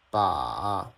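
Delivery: "ba" dipping low, then back up; third tone. Shown as a demonstration. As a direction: scoop the pitch down and up on "ba". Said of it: "go down, then go up".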